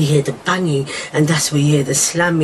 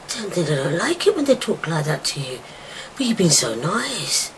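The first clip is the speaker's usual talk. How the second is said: ordinary talk, nothing marked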